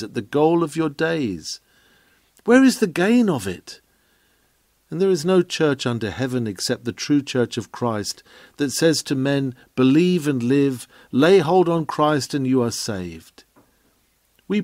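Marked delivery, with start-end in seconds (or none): none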